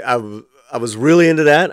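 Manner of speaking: usual speaking voice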